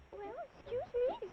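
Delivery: put-on very high, small child voice; elephant's child. Little elephant politely asking the snake, high child voice.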